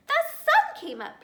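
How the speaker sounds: very high, loud chirp on sun; chirping squirrel. A narrator's reading. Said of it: "he chirped".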